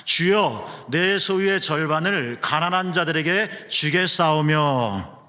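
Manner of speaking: slow, loud, earnest reading of scripture; solemn reading. Shown as a demonstration. Pastor leading the congregation in a solemn, slow vow. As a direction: read it gravely like Zacchaeus vowing. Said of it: "이거 좀 진지하게 한번 읽어보세요".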